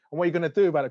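mock defiance at the guards; cheeky. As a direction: goad them, smart-mouthed. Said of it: "'Cause I'm getting a bit smart".

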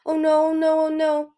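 high, loud, repeated dismay; horrified cry over an injured friend; anguished cry. A narrator's reading. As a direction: wail it in panic. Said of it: "Moon cried".

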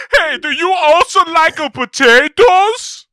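very high, loud, drawn-out cartoon shout; Robert De Niro. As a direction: belt it out slowly in an absurd high voice. Acting De Niro approaching Pesci.

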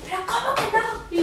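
pregunta aguda, de incredulidad; indignación imitada. Retold or imitated. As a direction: reclama sin creerlo. Reclamo indignado a la señorita. (reclamo incrédulo y agudo)

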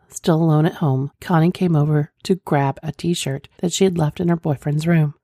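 usual speaking voice

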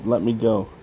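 plain reading voice

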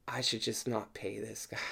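very soft, under-the-breath aside; private grumble. Retold or imitated. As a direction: mutter it to yourself. Retold private thought after treatment.

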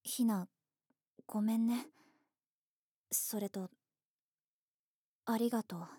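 whispered, embarrassed apology; bashful hushed words; shy whisper. Acted. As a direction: breathe it out, too shy to say it aloud. Shy apology and thanks to friend.

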